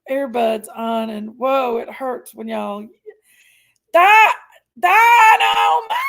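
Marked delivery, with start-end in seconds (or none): 3.9-6.0 loud, high drawn-out shout of the name; calling out. Real, not acted. Calling out to Dynamite to grab attention, loud drawn-out shout. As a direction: yell the name across the room, stretching it out.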